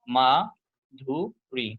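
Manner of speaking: মাধুরী very slowly in three beats, মা, ধু, রী; split into syllables. Shown as a demonstration. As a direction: pause after each syllable of মাধুরী. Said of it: "কয়টা আছে বলেন তো".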